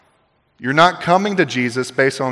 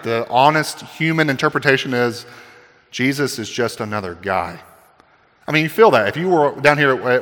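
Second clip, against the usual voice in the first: usual speaking voice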